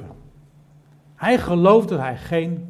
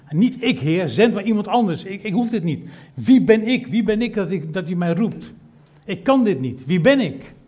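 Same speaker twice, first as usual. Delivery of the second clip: higher, louder anxious protest; a panicked, unworthy Moses; pleading Moses; 5 semitones higher, 4 dB louder than usual. Acted. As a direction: beg to be let off the task.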